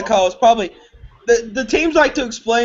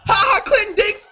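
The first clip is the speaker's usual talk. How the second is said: stunned disbelief; 10 semitones higher than usual. Real, not acted. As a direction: yell it in stunned surprise. Stunned by the draft pick.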